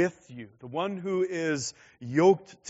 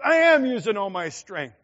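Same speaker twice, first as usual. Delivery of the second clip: loud, high, strained protest; a frustrated kid answering back; a frustrated boy protesting to his father; 7 semitones higher, 11 dB louder than usual. Retold or imitated.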